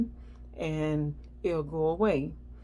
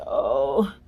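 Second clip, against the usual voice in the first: groaning in pain; 3 semitones lower, slower than usual. Retold or imitated. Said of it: "And I'm over here like".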